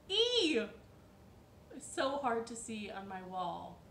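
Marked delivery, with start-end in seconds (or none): 0.1-0.6 excited reveal. Real, not acted. Revealing the letter.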